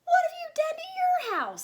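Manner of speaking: very high, loud outraged cry; neighbours shouting. A narrator's reading. The neighbours cry out at the ship next door. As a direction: shriek it at the house in shock.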